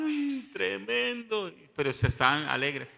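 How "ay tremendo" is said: slow, drawn-out and high, in a changed voice; retold amazed cry. Preacher imitating astonished onlookers at the miracle, drawn-out cry. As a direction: stretch it out like a stunned onlooker.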